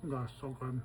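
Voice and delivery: talking while chewing; mouth full. Real, not acted. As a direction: say it through a mouthful. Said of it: "Don't talk with your mouth full".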